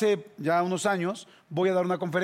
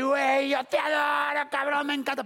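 high, drawn-out gushing shout; effusive drunk fan; 6 semitones higher, slower than usual. Retold or imitated. Pestering fan gushing at the speaker, high mock holler. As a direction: imitate a fan slowly hollering his adoration.

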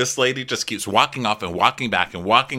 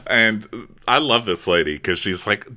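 ordinary talk, nothing marked

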